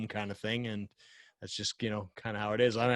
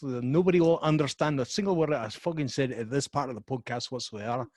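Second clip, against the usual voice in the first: put-on Scottish accent; 5 semitones higher, 5 dB louder than usual. Asked by his co-host, playful loud Scottish accent. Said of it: "Can you talk in a Scottish accent?".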